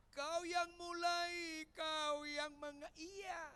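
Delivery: high, loud, slow accusation; accusing wife. Acted. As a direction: drag out each word at him. Acted wife accuses slowly.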